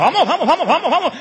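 put-on God urging the boy to call again, loud and high; urgent cheering. God urges Isaac on.

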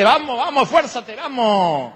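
high, mock-cheering push; pushy mover. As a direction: urge him on like the guy on top of the stairs. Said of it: "el que va arriba dice".

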